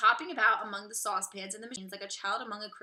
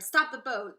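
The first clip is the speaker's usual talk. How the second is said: louder retold shout; 2 semitones higher, 9 dB louder, slower than usual. A narrator's reading. Narrator recalling Wonka's order, loud shout. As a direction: repeat the order with full volume. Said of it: "When Mister Wonka shouted".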